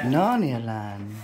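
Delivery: slow, drawn-out playful words. Real, not acted. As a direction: stretch it out dreamily. Whimsical doorway comment.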